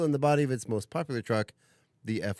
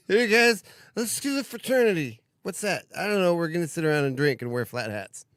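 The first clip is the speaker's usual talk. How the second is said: high, loud dopey frat bro voice; goofy frat guys; 6 semitones higher, 4 dB louder than usual. Acted. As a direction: play a clueless college bro. Acting out fraternity founders.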